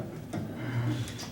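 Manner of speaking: a quiet, breathy chuckle; soft laugh. Real, not acted. Old man laughing softly at his own story.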